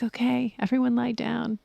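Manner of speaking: soft whispered closing cue; soothing whisper. Retold or imitated. Teacher closing meditation, soft and whispered. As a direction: hush the room down to rest. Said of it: "she has this voice that's so soothing".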